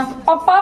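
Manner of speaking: पप्पा with doubled p, rising; Punjabi letter name. Shown as a demonstration. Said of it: "पापा ही तो हो जाएगा". (पंजाबी अक्षर पप्पा)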